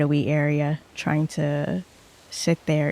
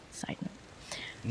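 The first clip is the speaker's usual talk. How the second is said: unvoiced whispered aside; 20 dB quieter than usual. Real, not acted.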